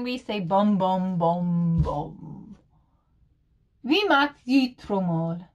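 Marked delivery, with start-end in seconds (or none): 0.0-2.6 bum, bum dropping lower and softer; drum beat. Shown as a demonstration. Showing viewers the German drum sound. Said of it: "So in German".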